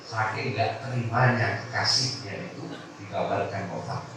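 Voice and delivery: normal talking voice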